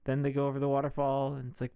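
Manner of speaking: usual speaking voice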